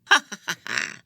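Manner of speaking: loud high cackle; a mad, bitter laugh thrown back; rueful cackling laugh. A narrator's reading. Prisoner laughs at the girl from her cell, rueful cackle. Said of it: "laughed a rueful cry".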